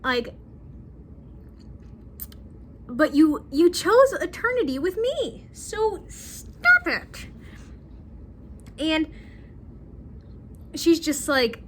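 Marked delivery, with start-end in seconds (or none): 0.0-7.3 exasperated Luke. Retold or imitated. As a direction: press him to a fed-up stop it. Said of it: "And he's like".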